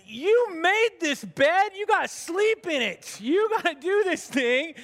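high, slow, emphatic tough-love retort; mock scolding. Pastor retelling his tough-love reply to moping Jim. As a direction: lay it on him, no sympathy. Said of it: "And I said".

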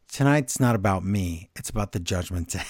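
low, grave voice, lightly acted; solemn Priest. Priest turning face, telling the group, low and solemn. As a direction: drop the voice low and make it solemn. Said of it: "He goes".

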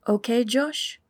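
put-on light German accent, asking; a hint of German in the question. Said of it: "A German accent".

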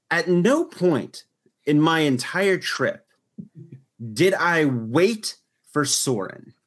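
deliberate, drawn-out emphasis; slowly and pointedly, with pauses between phrases; slow, emphatic. Real, not acted. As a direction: space out each phrase for emphasis. Making an emphatic boast.

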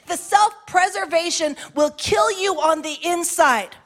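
rising, impassioned pulpit preaching; preaching at full force. Acted. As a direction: preach it hard to the congregation.

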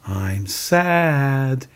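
modelling a feeling to be repeated; sustained, clearly voiced teaching example; Mister Rogers. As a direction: say it openly for someone to copy. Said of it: "He says to, uh, Lloyd, he goes".

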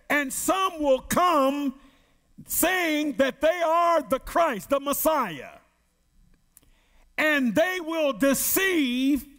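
slow, weighty scripture reading; slow, solemn preacher's reading; preaching scripture. Acted. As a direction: let each phrase land like a warning. Reads scripture in preaching cadence.

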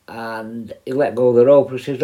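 usual speaking voice

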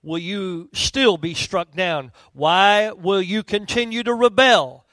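low, grave reading of the prophet's words; solemn Scripture reading. Acted. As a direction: read it like a weighty pronouncement from the pulpit. Reads Scripture aloud to congregation.

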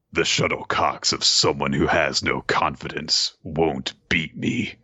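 low, solemn sports-manga declaration; dramatic. Acted. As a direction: deliver it as a grave, dramatic challenge. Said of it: "This is so dramatic".

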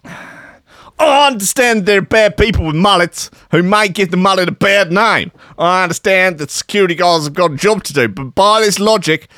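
high caricature Australian voice; hammed-up Australian indignation; scathing Aussie rant. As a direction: plead the case as an aggrieved Aussie punk. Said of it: "wrote a scathing message on Facebook".

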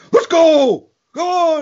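put-on loud high yelling of a kid gaming; kid yelling at Xbox. Son yelling to his friend over Xbox, loud and high. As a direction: shout it like an excited kid on the Xbox. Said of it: "I hear".

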